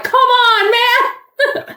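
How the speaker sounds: high exasperated call; theater groan. Retold or imitated. Whole theater yelling at Jax, high exasperated call. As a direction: call out at the screen in dismay. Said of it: "everyone in the theater was like".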